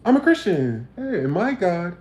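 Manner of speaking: sneering impression of a churchgoer. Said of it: "and go".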